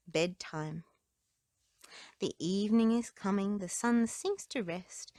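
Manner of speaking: usual speaking voice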